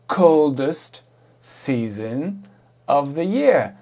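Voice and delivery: "coldest season" very slow and stretched, word by word; slow model example. Shown as a demonstration. Teacher recalling the example for learners, slow and emphatic. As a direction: draw out each English word clearly, with emphasis.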